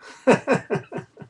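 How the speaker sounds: short breathy high laugh; a quick unvoiced chuckle after the song; high airy laugh. Real, not acted.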